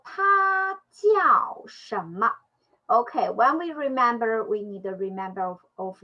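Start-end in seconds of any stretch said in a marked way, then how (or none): 0.0-2.3 他叫什么 read slowly with a higher lift; slow, raised question reading. Shown as a demonstration. Teacher reading 他叫什么 slowly to students.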